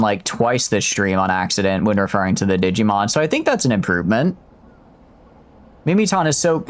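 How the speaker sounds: normal talking voice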